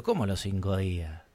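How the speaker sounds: low, soft, deadpan question; stunned disbelief. Retold or imitated. Customer questions clerk's five-day rule, quietly stunned. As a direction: let the voice drop in disbelief.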